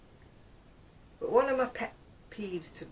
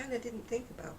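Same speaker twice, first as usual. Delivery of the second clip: ordinary talk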